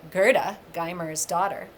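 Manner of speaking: plain reading voice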